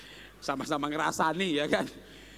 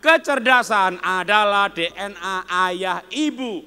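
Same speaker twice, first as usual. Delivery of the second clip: loud, slow group recitation; loud, slow unison reading; reading aloud together; 3 semitones higher, 10 dB louder, slower than usual. Real, not acted. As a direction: recite it slowly and loudly for all to follow. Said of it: "kita baca bersama-sama".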